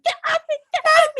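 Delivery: high, loud panicked scream of get off me; retold screaming. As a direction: shriek it at the biting hamster, reliving the panic. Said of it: "I'm, like, screaming".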